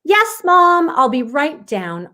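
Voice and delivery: put-on a child calling back eagerly; Aubrey replying. Girl answering her mom from upstairs, high and eager. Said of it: "Aubrey replied".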